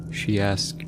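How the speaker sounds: ordinary delivery, nothing marked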